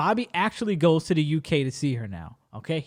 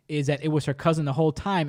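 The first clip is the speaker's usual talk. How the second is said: ordinary talk, nothing marked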